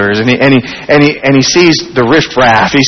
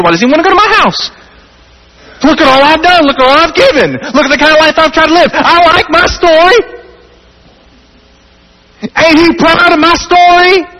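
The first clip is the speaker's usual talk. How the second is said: higher, indignant voice of a man proud of himself; offended self-righteous townsman; 11 semitones higher than usual. Retold or imitated. Preacher voicing townsfolk offended at Jesus, indignant whine. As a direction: whine it out as the offended good citizen.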